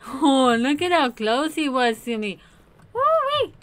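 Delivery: loud, high relieved woo-wee; just escaped, breathless relief; relieved excitement. Real, not acted.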